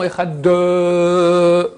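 "Echad de" with a long trailing vowel after d; added final "de". Shown as a demonstration. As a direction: tack a drawn-out "euh" onto "Echad de". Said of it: "Il y en a qui font".

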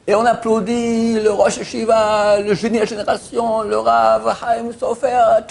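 annonce lente, plus haute et plus forte; présenter le Rav comme un maître de cérémonie; annonceur au micro. Retold or imitated. (le type au micro annonce le Rav, voix d'annonceur)

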